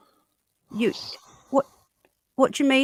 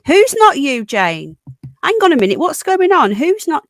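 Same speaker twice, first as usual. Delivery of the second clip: higher, louder startled questions; real surprise; 5 semitones higher, 7 dB louder than usual. Real, not acted. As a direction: caught off guard, demanding to know what's going on. Sudden confusion on stream.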